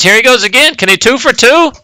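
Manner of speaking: keyed-up game commentator; excited commentary. Real, not acted. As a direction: call the play with rising anticipation. Live commentary as big play builds.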